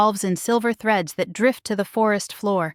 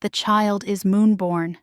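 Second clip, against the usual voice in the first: usual speaking voice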